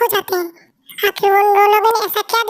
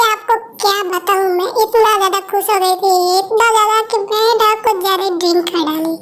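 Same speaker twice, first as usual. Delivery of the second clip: ordinary talk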